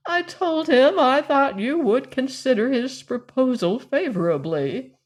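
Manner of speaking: a hint of a gossipy aunt; fussy old cousin. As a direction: coax her like a meddling old relative. Georgiana coaxing Valancy.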